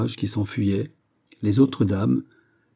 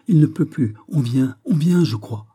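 higher, louder urgent warning; interrupting; 6 semitones higher, 4 dB louder than usual. A narrator's reading. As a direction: cut in with anxious alarm. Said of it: "interrompit la jeune fille". (avertissement pressant et inquiet)

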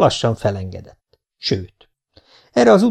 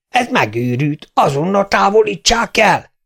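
furious mayor; 10 semitones higher than usual. A narrator's reading. Mayor demands removal, furious.

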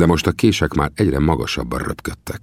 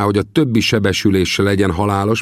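usual speaking voice

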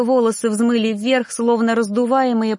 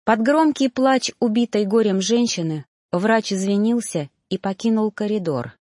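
usual speaking voice

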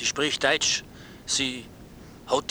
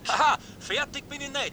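high, tearful whine of protest; a comic, tearful whine; whiny protest; 12 semitones higher than usual. Acted. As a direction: wail it like a hurt husband. Husband protests, voice cracking.

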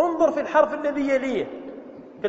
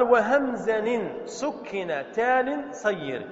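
slow, drawn-out recital of verse; verse recitation; much slower than usual. Acted. Teacher recites a grammar verse to students, slow and measured. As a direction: recite the line word by word, slowly.

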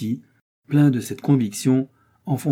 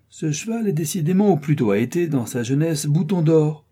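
mocking; 6 semitones higher, 3 dB louder than usual. A narrator's reading. Stranger mocks the horse.

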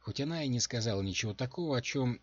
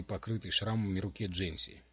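plain reading voice

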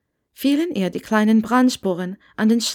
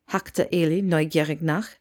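ordinary delivery, nothing marked

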